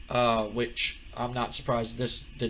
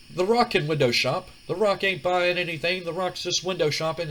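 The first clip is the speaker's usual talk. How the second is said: a bit higher and louder cocky boast; The Rock, cocky; 6 semitones higher, 4 dB louder than usual. Retold or imitated. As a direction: brag about yourself in third person. The Rock voiced, third person.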